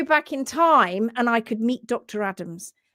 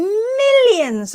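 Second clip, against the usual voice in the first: "millions" stretched long, high and loud; stress on millions; 12 semitones higher, 7 dB louder than usual. Real, not acted. Telling how horses would have been saved, "millions" drawn out high. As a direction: swell hugely on "millions". Dramatic climax of imagined story.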